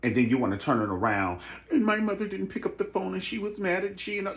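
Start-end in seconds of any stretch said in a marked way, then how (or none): 1.7-4.3 high, mimicking complaint; mock griping. Mocking a castmate's gripe about her mother, high mimicry.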